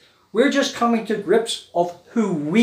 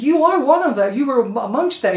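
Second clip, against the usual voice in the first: accusing; 6 semitones higher, 5 dB louder than usual. Acted. Accusers confront Peter.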